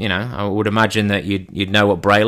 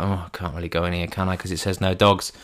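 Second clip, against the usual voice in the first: muttering to himself; 4 semitones lower, 8 dB quieter than usual. Retold or imitated. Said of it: "And he's like".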